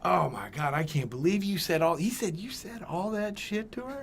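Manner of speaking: ordinary talk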